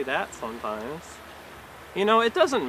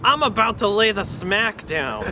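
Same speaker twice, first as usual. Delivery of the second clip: higher, louder wrestler promo yell; wrestler impression; 5 semitones higher, 6 dB louder than usual. Comic to the crowd as a wrestler, loud hype yell. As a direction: bellow it like a pro wrestler cutting a promo. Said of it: "my impression of a wrestler who's trying to quit heroin".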